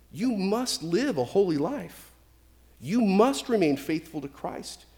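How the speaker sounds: ordinary delivery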